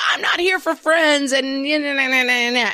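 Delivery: a high mock-tough reality girl; super angry reality star. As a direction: toss it off like a reality-show diva. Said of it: "the super angry girl is like".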